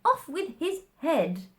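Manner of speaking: high, loud furious Queen; bawled royal order. Acted. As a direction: roar the sentence as the Queen. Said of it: "bawled out".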